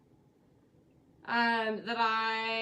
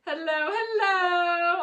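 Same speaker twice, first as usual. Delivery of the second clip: drawn-out hello; 6 semitones higher, 5 dB louder, much slower than usual. Real, not acted. Cheery drawn-out greeting.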